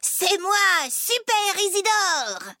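high, drawn-out superhero cry; gleeful high-pitched proclamation; gleeful boasting. Acted. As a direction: crow slowly like a triumphant kid. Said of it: "puis il éclate de rire".

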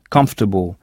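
"Comfortable," said KUMF-tuh-bl, stress on the first syllable; English pronunciation. Shown as a demonstration. Teacher shows the English word to French learners. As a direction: say "Comfortable," with the O and R nearly silent. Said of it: "O R est à peine audible".

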